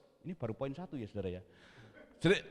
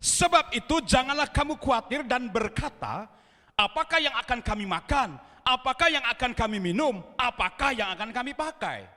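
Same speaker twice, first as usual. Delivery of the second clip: full-force preaching; 7 semitones higher, 6 dB louder than usual. Acted. Pastor preaching to the congregation not to worry, loud and emphatic. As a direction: drive the point home to the church.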